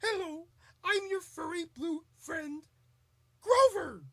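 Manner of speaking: high, friendly puppet voice; Grover. Acted. Furry blue puppet introducing himself, high and eager. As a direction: greet in a high puppet voice, name called out loud. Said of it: "That's Grover and Super Grover".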